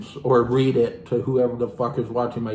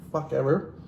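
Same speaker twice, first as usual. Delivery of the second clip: ordinary delivery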